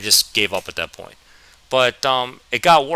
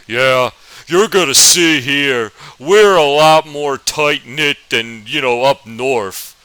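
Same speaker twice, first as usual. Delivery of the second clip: slow, louder Southern drawl; Southern teacher voice; 6 dB louder, slower than usual. Retold or imitated. History teacher greeting northern kid, slow Southern drawl. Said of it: "That's actually how he sounded".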